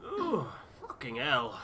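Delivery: a hint of a grumbling sailor; grumpy Tucker. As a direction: growl it out like a fed-up old sailor. Character Tucker voiced in game.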